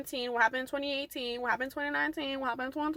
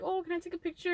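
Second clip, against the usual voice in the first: retold excited request, high and soft; excited asking; 5 semitones higher, 6 dB quieter, faster than usual. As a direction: ask eagerly in a light, higher voice. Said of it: "I'm, like, very excited".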